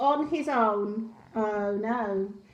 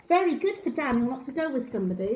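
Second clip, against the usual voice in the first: plain reading voice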